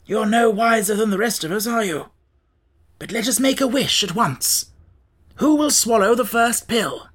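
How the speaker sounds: lightly voiced Scarecrow, brisk. Scarecrow challenges his companions to make a wish, lightly voiced, lively.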